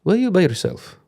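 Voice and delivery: high, lilting Eastern European waiter voice, concerned; a Balkan waiter fussing over a lonely diner; vague European accent. Retold or imitated. Waiter asking a solo diner, in a high Eastern European accent. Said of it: "I was trying to do a vague European accent".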